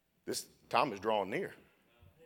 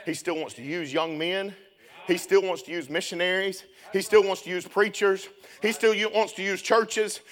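rising steadily higher and louder, hammering on still; building preaching; 10 semitones higher, 10 dB louder than usual. Acted. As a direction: climb with each line toward a shout.

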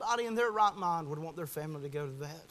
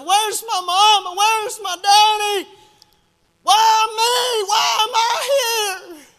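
high, long-drawn desperate wail; imitated screaming child; 18 dB louder, slower than usual. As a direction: cry out loudly like a child left alone. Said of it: "She went on to scream".

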